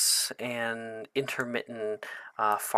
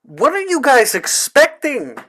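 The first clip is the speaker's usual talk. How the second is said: NPC voice; 14 semitones higher, 11 dB louder than usual. Acted. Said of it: "and he's like".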